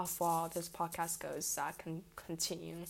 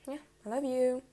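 normal talking voice

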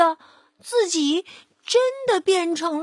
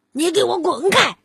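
louder wolf's angry shout; wolf snaps at the piglet, louder; roaring; 4 semitones higher, 5 dB louder than usual. Acted. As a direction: bark him away, losing his temper. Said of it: "忍不住大声地吼道".

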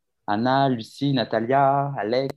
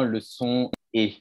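ordinary talk, nothing marked

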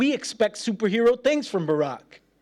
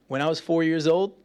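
ordinary talk, nothing marked

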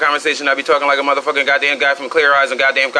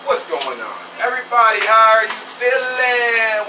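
hype greeting; 9 semitones higher than usual. Acted. Hyping his podcast audience.

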